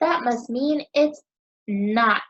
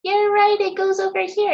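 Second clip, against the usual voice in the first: excited praise; 8 semitones higher, 4 dB louder, faster than usual. Real, not acted. Teacher praising children's answer.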